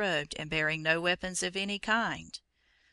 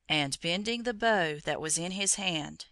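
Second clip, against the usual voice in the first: ordinary delivery, nothing marked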